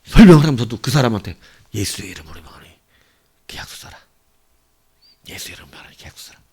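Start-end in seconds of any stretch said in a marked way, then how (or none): 1.7-6.4 whispered under the breath; silent inner prayer. Retold or imitated. Preacher inwardly commanding a buyer to sign, whispered. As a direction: murmur the command secretly to yourself. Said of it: "속으로 계속 제가 했던 습관이 있어요".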